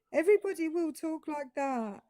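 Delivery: "everybody will talk like that" pitched high in a lilting sing-song; wrong British musicality. Shown as a demonstration. Brit mimics Americans' bad British cadence, "everybody will talk like that" sung high. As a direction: lift "everybody will talk like that" into an overdone tune. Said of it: "musicality with which they say them".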